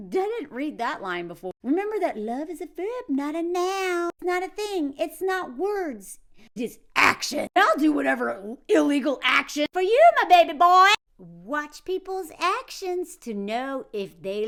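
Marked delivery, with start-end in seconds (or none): 1.6-10.9 lightly voiced gushing mom, a touch louder; hint of an overbearing mom reciting vows; mock doting mother. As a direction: read it like a smothering mother pledging anything. Reads the mother's letter aloud.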